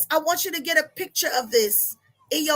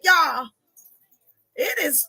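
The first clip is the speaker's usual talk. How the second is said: ordinary talk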